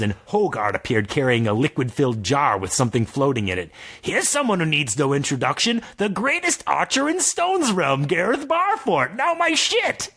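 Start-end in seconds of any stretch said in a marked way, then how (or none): 4.0-10.1 wizard voice. Acted. Narrator voicing Morban.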